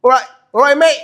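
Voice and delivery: loud, high Cockney greeting; Cockney accent. Retold or imitated. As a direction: imitate a Cockney bloke calling out. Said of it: "has got Cockney accent".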